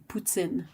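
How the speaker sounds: poutine said "poo-tsin" with a nasal final vowel; French Canadian poutine. Shown as a demonstration. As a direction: poutine the Québec way, ending "tsin". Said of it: "Do you pronounce the N?".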